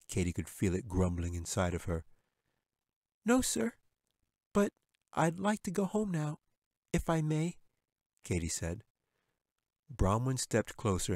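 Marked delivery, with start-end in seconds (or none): 3.2-7.6 put-on high, polite girl's voice; timid little girl. A narrator's reading. Katy answering the bear, high and timid.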